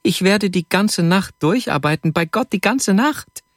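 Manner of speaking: high, rising, eager promise; excited vow. A narrator's reading. Vasja jumps up, vows to work. (aufgeregtes, hohes Versprechen)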